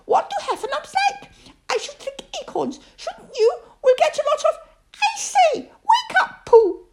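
high piping voice, brisk and bossy, breaking into giggles; a tiny pig briskly hustling his dozy friend; squeaky Piglet. Acted. Piglet cuts off Pooh's honey dream, high and squeaky.

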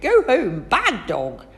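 loud scolding shout. A narrator's reading. Patrick yells at the hounds, loud scolding shout. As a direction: drive the dogs off. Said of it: "shouted Patrick".

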